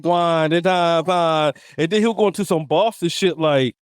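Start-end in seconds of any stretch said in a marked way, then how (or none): 0.0-1.5 lightly mimicked Jamaican accent. Podcast host imitating his drinking buddy, mock Jamaican accent. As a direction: imitate a friend's Jamaican patois greeting. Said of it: "in and out his Jamaican accent".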